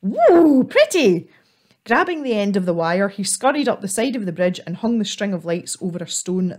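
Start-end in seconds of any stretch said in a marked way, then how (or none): 0.0-1.3 high, loud delighted squeal. Acted. Squirrel squealing at the fairy lights, high and loud. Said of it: "Elf squealed".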